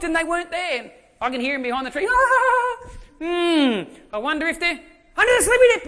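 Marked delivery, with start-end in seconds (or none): 2.1-5.9 high, loud mock-wondering voice; hammed-up searching. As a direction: pretend to hunt for hiding kids. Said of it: "I'll play along with them".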